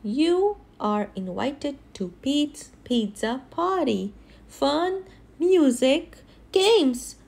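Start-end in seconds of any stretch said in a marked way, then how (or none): none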